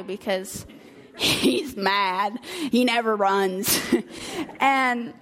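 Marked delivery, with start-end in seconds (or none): none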